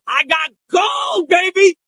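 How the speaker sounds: slow, drawn-out triumphant shout; gleeful. Retold or imitated. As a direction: savor it like hitting the jackpot. Thrilled at the viral moment.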